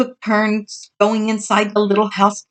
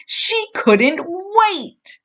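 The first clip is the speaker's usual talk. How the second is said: eager; 11 semitones higher, much slower than usual. A narrator's reading. Storyteller's happy finale.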